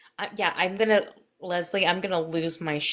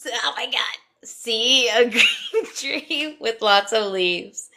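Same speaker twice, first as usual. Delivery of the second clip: giggling loud and high through words; laughing; 6 semitones higher, 9 dB louder, slower than usual. Real, not acted. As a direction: crack up mid-sentence. Breaks into laughter mid-induction.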